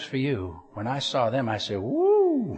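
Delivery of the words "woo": excited whoop. Real, not acted. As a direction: whoop with delight at the dessert. Sees the cinnamon buns.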